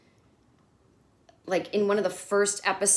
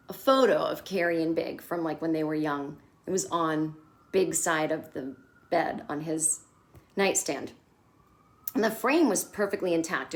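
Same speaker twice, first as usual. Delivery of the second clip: ordinary talk, nothing marked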